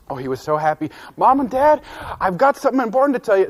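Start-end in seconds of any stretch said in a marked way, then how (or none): 1.2-3.5 put-on eager boy. Excited boy bursting with news.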